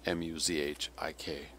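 M U Z H I K spelled out, softly; spelled out letter by letter. Shown as a demonstration. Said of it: "that's a peasant".

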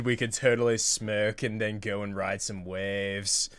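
ordinary talk, nothing marked

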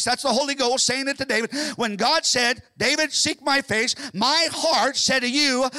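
loud, high, ringing sermon voice; fervent revival preaching; full-force preaching. Acted. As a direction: preach it out to the whole church. Preaching scripture, building.

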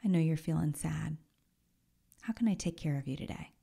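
soft, hushed and caring; loving inner voice soothing a sad friend; tender whisper. Pretend. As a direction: whisper it like a gentle parent. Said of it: "developed this relationship that says".

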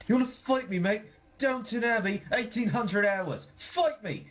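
high-pitched, play-aggressive dare; hammed-up scrappy challenge; mock fight challenge. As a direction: pick a pretend fight.

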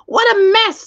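high squawking bird voice; screeching parrot. Acted. Parrot screeches at the muddy gown. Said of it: "screeched the parrot".

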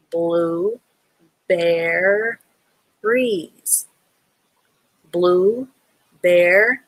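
"Blue" then "bear" split apart and slow; sounding out. Shown as a demonstration. Teacher breaking a word into sounds for a student. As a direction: break "blueberries" into its parts. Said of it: "The B E R has that bear sound".

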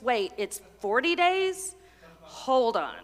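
ordinary talk, nothing marked

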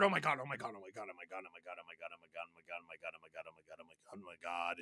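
breathless frightened muttering; panicked whisper. Real, not acted. Player hurt, panicked.

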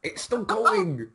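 high, slow panicked cry; alarmed shout. Real, not acted. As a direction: wail it in alarm. Panicking as recording runs on.

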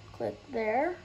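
ordinary talk

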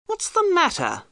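startled angry colonel; angrily. A narrator's reading. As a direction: snap it at the boy who ran into you. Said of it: "the Colonel asked angrily".

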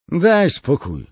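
high, loud scoffing soldier; mock grumble. Tall soldier scoffing at Beard, high loud jeer. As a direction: roll your eyes at him. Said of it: "mruknął wysoki mężczyzna".

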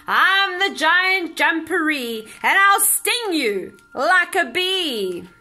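big, slow, loud giant boom; booming monster voice. A narrator's reading. Unseen giant booming from the burrow, loud and slow. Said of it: "he heard a loud voice".

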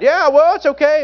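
high, easygoing Southern teen impression; [name]. Teen reassuring a waitress, high imitated voice. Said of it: "He's just talking like this".

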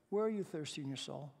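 normal talking voice

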